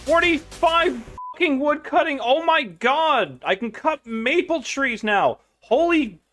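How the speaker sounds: very high, drawn-out squeal of excitement; excited celebration. Real, not acted. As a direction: celebrate in an over-the-top high voice. Celebrates a level-up in game.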